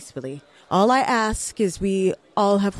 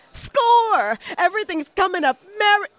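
high, crowing celebration; gloating triumph; 10 semitones higher than usual. Acted. Poisoner celebrates over her drugged victims, gloating triumph. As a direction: crow it out in soaring pitch.

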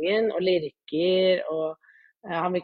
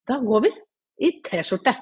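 raised, exasperated give-up voice; exasperated mock announcement; 6 semitones higher, 6 dB louder than usual. Mother snapping at her refusing son, exasperated. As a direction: throw up your hands and declare it loudly. Mother giving up, quoting herself.

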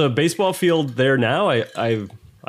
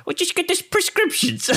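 a much higher, hammed-up Tony Soprano; Tony Soprano impression. Friend mocking Tony Soprano on prescriptions, hammed-up impression. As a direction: mangle it like a bad Tony Soprano bit. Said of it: "Tony was talking about prescriptions".

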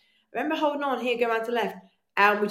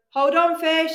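louder and higher, calling out a warning; retold call; 6 semitones higher, 9 dB louder, slower than usual. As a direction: shout to him to hold on. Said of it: "I heard this voice going".

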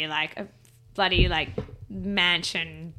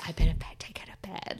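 whispered; 4 semitones lower, 6 dB quieter than usual. Retold or imitated. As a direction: whisper it low and hushed. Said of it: "I remember we were like".

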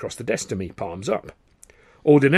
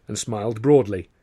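plain reading voice

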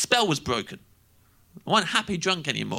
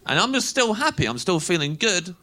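ordinary delivery

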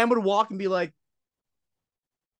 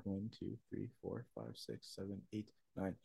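very soft, low count under the breath; tally quietly down a list; barely voiced running count; counting to oneself; 13 semitones lower, 24 dB quieter than usual. Real, not acted. Counting standings to himself.

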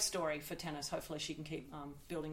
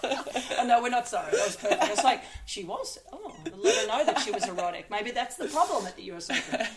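higher and louder, laughing throughout; laughing tease; 4 semitones higher, 6 dB louder than usual. Real, not acted. Cohost reassuring the guest about the slip, laughing. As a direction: giggle through the teasing.

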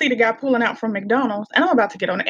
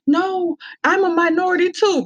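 higher-pitched imitation of a protesting officer; pleading officer; 8 semitones higher than usual. Officer reassuring a driver he pulled over, high mock plea. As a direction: mimic him pleading in a raised pitch.

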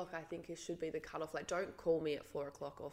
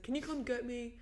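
mild imitation of a slurring drunk, higher pitch; 6 semitones higher than usual. Drunk boyfriend phoning at midnight for a lift, imitated slurring. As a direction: lightly mimic a drunk's slurred plea.

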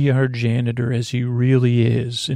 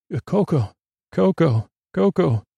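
huffing and puffing, out of breath; 4 semitones higher than usual. Acted. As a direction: call out between gasps for breath. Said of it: "a little bit out of breath".

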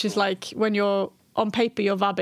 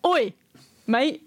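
mock confrontational "Oj, mate", higher and sharp; aggressive oi; 4 semitones higher than usual. Bumped into in the UK, mock aggressive "Oj, mate". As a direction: throw a mock challenge at a stranger. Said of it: "it sounds a lot more aggressive".

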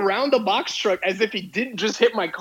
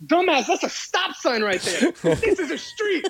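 exasperated yell; 8 semitones higher than usual. Retold or imitated. Insulting the worker.

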